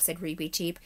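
plain reading voice

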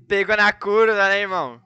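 loud, slow, high villain boom; booming echoing villain monologue. Pretend. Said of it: "Risada de vilão". (voz de vilão, lenta e retumbante)

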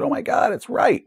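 breathy oh my God, then a sudden high squeak; hammed-up player suddenly won over; mock realization. Player realizing the game master was right, mock surprise. Player discovers GM's way works.

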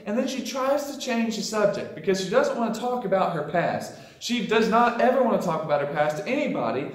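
normal talking voice

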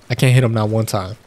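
usual speaking voice

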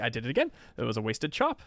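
normal talking voice

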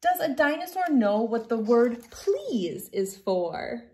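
gentle storybook reading voice; reading to children. Acted. Reads picture book aloud.

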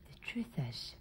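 hushed secret whisper. Acted. Creepy woman whispering a secret to Emily. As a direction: tell her the secret softly.

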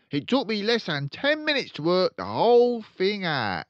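pompous father voice; loud pompous father. Acted. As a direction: boom it out like a showy salesman.

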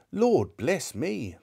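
slow, high astonished cry; as if the breath is knocked out; breathless surprise. Acted. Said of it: "cried the gentleman, as if his breath were gone".

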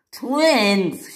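high, indignant exclamation; shouted. A narrator's reading. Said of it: "shouted Caitlin".

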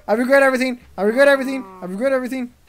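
high, loud frantic repetition; panicked regret. Real, not acted. Player fleeing an attack, yelling in panic. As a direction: yell it over and over in panic.

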